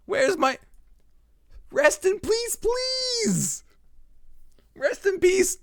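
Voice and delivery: ordinary talk, nothing marked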